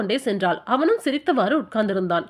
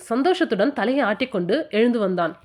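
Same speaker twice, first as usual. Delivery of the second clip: ordinary delivery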